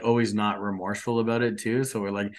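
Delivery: usual speaking voice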